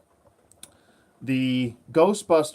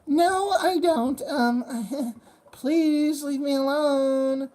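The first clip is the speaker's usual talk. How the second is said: very high, slow falsetto brush-off; hammed-up reluctant lady; woman asking the nerd to leave her alone; rebuffing woman; 12 semitones higher, much slower than usual.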